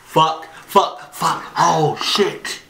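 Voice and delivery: high, bursting curses in fright; startled panic. Real, not acted. Viewer reacting to a scary clip, panicked. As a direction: curse in a sudden jolt of fear. Scared by the video's jump.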